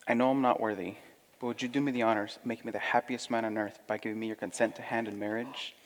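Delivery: soft, sincere suitor; rehearsing a proposal. Acted. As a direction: ask for her hand tenderly. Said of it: "I want to practice my proposal".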